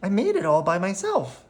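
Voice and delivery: put-on high, proud child's boast; proud little girl. A narrator's reading. Sophia telling her neighbour proudly in a high child's voice. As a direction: brag like a proud kid. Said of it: "Sophia tells her".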